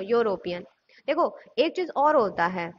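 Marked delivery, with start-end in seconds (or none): 0.0-0.6 "यूरोपियन" low, starting "yo"; initial Y glide. Shown as a demonstration. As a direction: say "यूरोपियन" lower, as yo-ro-pi-an. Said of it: "pronunciation हो".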